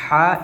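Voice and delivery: ہا pushed from the middle of the throat. Shown as a demonstration. Teacher showing the class the middle-throat letter, ہا from mid-throat. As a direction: say a strong, drawn-out ہا from mid-throat. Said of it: "یہ جو بیچ سے ادا ہوتا ہے".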